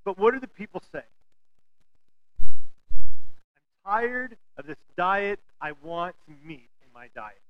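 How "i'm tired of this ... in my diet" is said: drawn-out mock complaint, starting slow; slow whine. Israelites grumbling about manna, slow whiny complaint. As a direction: drag the complaint out like a tired child.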